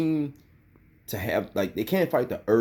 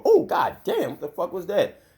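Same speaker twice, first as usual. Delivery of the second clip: startled yelp; 8 semitones higher than usual. Acted. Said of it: "make you go".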